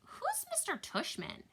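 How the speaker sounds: slow, high sleepy question; drowsy, high-pitched girl; groggy. A narrator's reading. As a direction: ask as if just woken up. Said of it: "Via said groggily".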